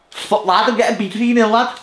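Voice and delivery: high, loud exasperated shout; real gamer rage, cracking high; frustrated yell. Real, not acted. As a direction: yell it at the screen while losing. Losing at FIFA, yelling.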